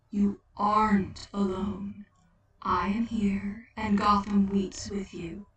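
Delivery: a solemn figure promising company; Death's consoling voice. Acted.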